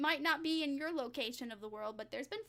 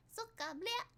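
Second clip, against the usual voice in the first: Russian impression; 9 semitones higher than usual. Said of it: "that's my Russian impression".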